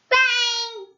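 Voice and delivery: shouted sound effect bang; high loud story bang. A narrator's reading. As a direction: belt out the bang.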